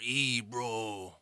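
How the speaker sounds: East L.A. Chicano accent, drawn-out greeting; hardcore East L.A. accent. Shown as a demonstration. Demonstrating East L.A. talk for a Bostonian, hardcore Chicano accent. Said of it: "Maybe like a hardcore".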